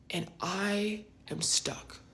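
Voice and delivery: slow, hushed and stunned. Retold or imitated. Storyteller recalling arrival at mini golf, stunned hush. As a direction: drop to a slow stunned near-whisper.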